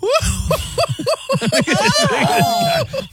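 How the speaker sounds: long, very high mock-amused whoop; high whooping cackle. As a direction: let out a drawn-out falsetto whoop. Reacts to caller's loser insult.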